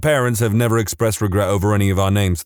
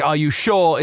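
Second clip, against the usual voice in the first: "Are you sure?" in a regional accent, higher and falling, "sure" like "Shaw"; 5 semitones higher than usual. Shown as a demonstration. Speaker shows how the question sounds like the name in a regional accent. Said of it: "in my regional accent".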